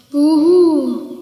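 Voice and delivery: drawn-out spooky ghost wail; ghost boo. Acted. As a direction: moan it like a ghost. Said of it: "Dann kam wieder dieses komische Geräusch".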